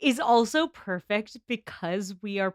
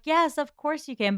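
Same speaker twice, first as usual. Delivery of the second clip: passive-aggressive; 6 semitones higher than usual. Retold or imitated. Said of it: "so passive-aggressive about it".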